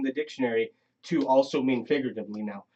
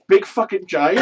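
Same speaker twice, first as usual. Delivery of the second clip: excited; 6 semitones higher, 8 dB louder than usual. Retold or imitated. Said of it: "In my head, immediately".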